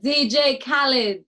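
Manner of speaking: slow, loud hype-man shout of the name; mock DJ shout; hype man call. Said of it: "I'd be a bit more like".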